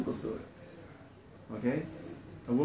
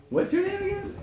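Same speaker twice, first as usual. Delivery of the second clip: disbelief; 3 dB quieter than usual. Retold or imitated. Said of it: "They're like".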